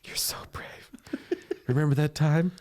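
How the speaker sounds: hushed, sinister pity; mock sinister whisper to a dying man; creepy whisper. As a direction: whisper it with a finger to your lips. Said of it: "Finger over your lips now".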